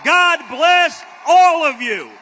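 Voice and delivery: ordinary talk, nothing marked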